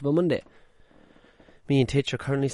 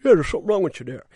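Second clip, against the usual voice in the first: quick, louder, offhand verdict; mock therapist; 5 semitones higher, 5 dB louder, much faster than usual. Podcaster mocks a stranger therapist giving a quick diagnosis, fast and dismissive. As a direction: rattle off the diagnosis fast and careless. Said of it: "to say".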